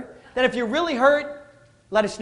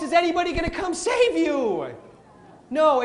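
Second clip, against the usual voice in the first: ordinary delivery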